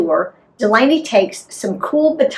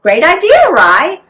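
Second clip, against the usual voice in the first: higher and louder, delighted mom; cheerful approval; 8 semitones higher, 6 dB louder than usual. A narrator's reading. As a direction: praise the idea brightly. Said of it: "Mom says".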